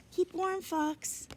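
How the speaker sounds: put-on high, friendly kid farewell; high cheerful goodbye. A narrator's reading. Charles says goodbye to the squirrel, high and cheerful. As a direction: wave it off brightly up high.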